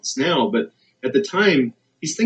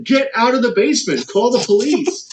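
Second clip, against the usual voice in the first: urgent command; 9 semitones higher than usual. Retold or imitated. Kevin ordering Jane out.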